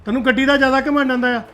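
loud stern rebuke of the wife; angry scolding. Acted. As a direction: come down hard on her. Husband scolds his wife. (ਘਰਵਾਲੀ ਨੂੰ ਗੁੱਸੇ ਨਾਲ ਝਿੜਕਣਾ)

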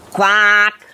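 drawn-out, level-pitched quack; a lightly voiced duck quacking. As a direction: hold one long quack on an even note.

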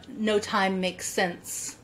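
normal talking voice

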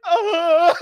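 high imitated child's wail; mock frightened scream. Kid facing dad's belt, mock scream. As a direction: cry out like a scared kid, hammed-up.

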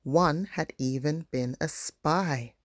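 usual speaking voice

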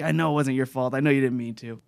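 soft, toned-down quote of a player; understated retold yelling. Explaining the friends' exchange, understated quiet retelling. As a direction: report the yelled words quietly. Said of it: "so he's yelling, but, like".

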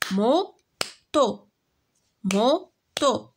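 موتو said slowly as mo-to, two separate beats; syllables clapped out. Shown as a demonstration. Teacher to pupils, counting syllables: موتو split slowly into mo-to. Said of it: "دو سيلاب".

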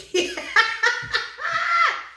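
high, loud burst of laughter; real shrieking laugh. Real, not acted. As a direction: crack up loudly. Laughing at cold signs.

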